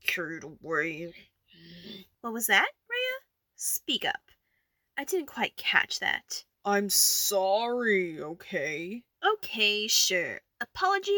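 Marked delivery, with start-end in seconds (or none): 2.2-6.4 mocking. Forcing a reluctant apology. 6.6-9.0 slow, drawn-out reluctant sorry; grudging apology. Acted.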